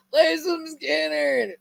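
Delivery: high, drawn-out imitation of a yell; mock heckler yell. Somebody yelling a request at a dive bar gig, mock yell. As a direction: imitate a bar crowd's shout without full volume. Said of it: "somebody yells".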